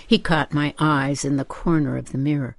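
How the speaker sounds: plain reading voice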